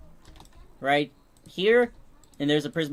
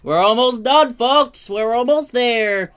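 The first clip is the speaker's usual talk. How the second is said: excited announcer; 7 semitones higher, 8 dB louder, slower than usual. Real, not acted. Announcing progress to viewers.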